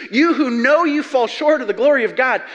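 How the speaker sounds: ordinary delivery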